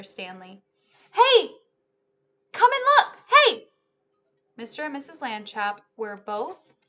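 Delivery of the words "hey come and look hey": high, loud excited calling. A narrator's reading. Arthur calls his parents from the bedroom, loud and high. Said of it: "called from the bedroom".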